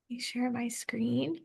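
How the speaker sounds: whispered aside to herself; whispering. Real, not acted. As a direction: drop to a soft whisper. Aside muttered while sharing screen.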